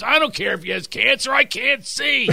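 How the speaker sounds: high-pitched mock Philadelphia heckler; whine-shout back like an annoyed sports fan, hammed up; heckler's yell.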